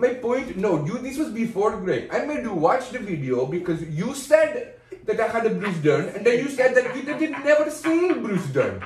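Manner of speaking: put-on Indian accent. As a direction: press the argument home in an Indian accent. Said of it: "how good my Indian is".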